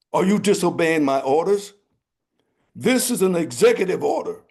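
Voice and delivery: fast, loud royal threat; menacing king. Acted. King threatening disobedient guards, fast and loud. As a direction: rattle it off as a threat.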